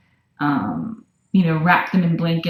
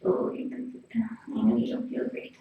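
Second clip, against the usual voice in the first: whisper; 2 semitones higher than usual. Real, not acted. Whispering to the sick cat.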